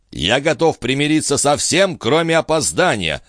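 usual speaking voice

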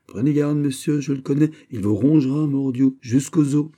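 high, emphatic warning; animated, oath-laden caution; urgent warning. Acted. Animated warning against a money devourer.

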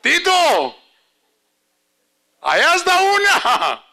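put-on high, loud little girl calling out to grandpa; child's thrilled high-pitched cry, slowing on the last words; excited little granddaughter. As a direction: shout it like a four-year-old spotting McDonald's.

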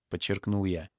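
usual speaking voice

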